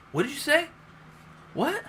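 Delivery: high squeaky what did you say; high mock questioning. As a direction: pitch way up, mocking. Imitating Bale Batman's gruff questions.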